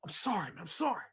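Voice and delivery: soft sheepish sorry; meek apology. Retold or imitated. Heckler apologising to him, soft and meek. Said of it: "He's apologizing and stuff".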